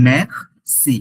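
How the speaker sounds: ম্যাক্সি said slowly, drawn out, every sound clear; slow pronunciation demo. Shown as a demonstration. Teacher to student, slow clear ম্যাক্সি. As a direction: spell out ম্যাক্সি slowly for the learner. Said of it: "So এটা ম্যাক্সি".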